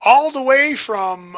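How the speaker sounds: booming game show host intro; high, loud hype-man announcement; announcer voice. Pretend.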